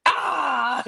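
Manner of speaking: imitated scare yell; mock scare shout. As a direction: yell it to startle. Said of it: "he comes behind him like".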